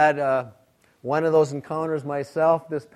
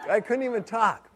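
laughing; 6 semitones higher than usual. Real, not acted. Laughing retelling pepper burn.

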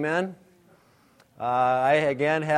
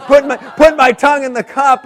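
laughing; 7 semitones higher, 10 dB louder, faster than usual. Real, not acted. Laughing while retelling pepper panic.

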